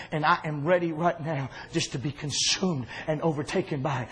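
soft, low, heavy-hearted confiding; hushed grief. Acted. Jesus confiding in his fellas that he could die, soft and low. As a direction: let each line sink down quietly.

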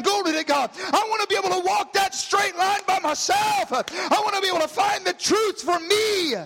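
building from firm declaration to high shouted preaching; full-force preaching. Real, not acted. Preacher declaring his own faith to God, building to a shout. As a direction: drive each line harder, climbing in pitch.

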